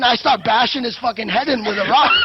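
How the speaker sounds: real laughter breaking into the words; laughing. Real, not acted. Telling friend about bashing the player's head, laughing. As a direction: crack up while telling it.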